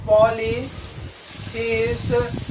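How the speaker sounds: Polytheist stretched out in parts; polytheist spaced out for learners; slow repeat. Shown as a demonstration. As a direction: say polytheist slowly, breaking it into parts. Repeats answer slowly for learners.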